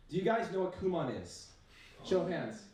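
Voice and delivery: usual speaking voice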